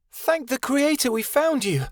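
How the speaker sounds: high, shouted cry of relief; relieved shout. A narrator's reading. Vivienne finding Kia in battle, shouting in relief. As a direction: call it out, thankful and overjoyed. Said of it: "clinging to Kia and shouting".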